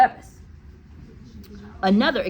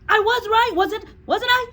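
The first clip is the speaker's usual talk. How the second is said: put-on very high, loud pleading child; anxious schoolboy; 7 dB louder than usual. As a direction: squeak it hopefully as a small boy. Eric asks nervously.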